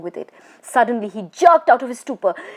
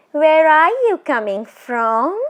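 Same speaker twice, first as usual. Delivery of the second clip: high, eerie teasing spirit voice; a tall witch toying with her prey; ghost woman; 7 semitones higher, 6 dB louder than usual. Acted. Ghost woman teasing the frightened Bishan Singh, eerie and high.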